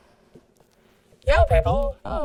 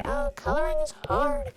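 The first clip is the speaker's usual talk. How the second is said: mock whiny complaint; 11 semitones higher than usual. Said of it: "Don't say".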